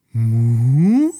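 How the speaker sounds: loud, questioning cow moo; a baffled cow lowing loudly; puzzled moo. Acted. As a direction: moo as if asking where it went. Cow finds dandelion gone.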